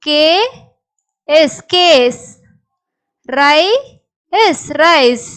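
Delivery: each word split, pause, then blended; sounding out. Shown as a demonstration. Teacher showing what all these words become, sounded out. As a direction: give the first part, wait, then add S. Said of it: "So, எல்லாமே என்ன ஆகுது?".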